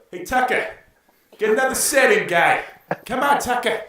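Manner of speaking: put-on high, loud Boston accent yell. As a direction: holler at Tucker in a hammed-up Boston accent. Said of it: "How about Boston?".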